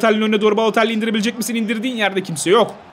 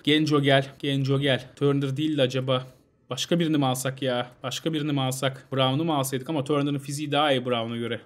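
much softer, lower muttering to himself; quiet thinking aloud; 6 semitones lower, 6 dB quieter than usual. Real, not acted. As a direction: talk it over under your breath. Planning substitutions, muttering to self.

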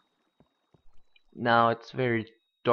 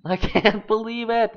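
laughing; 10 semitones higher, 4 dB louder than usual. Real, not acted. Laughing at lapis luck.

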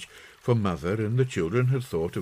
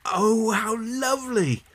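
put-on high child's voice, thrilled; boy gasping over a present; high-pitched exclamation of delight; delighted cry; 4 dB louder than usual. A narrator's reading. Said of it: "cried John".

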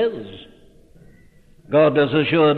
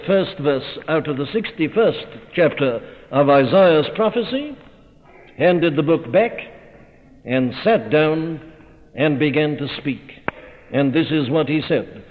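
ordinary delivery

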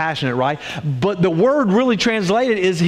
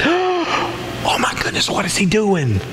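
shocked crowd; 2 semitones higher, slower than usual. Retold or imitated. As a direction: react as a stunned onlooker. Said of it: "can you hear the crowd".

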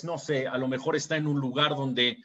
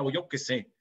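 usual speaking voice

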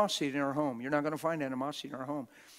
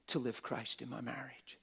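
whispered prayer; 3 semitones lower, 10 dB quieter than usual. Retold or imitated. Quoted prayer, quiet whisper.

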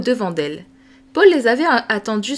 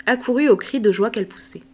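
usual speaking voice

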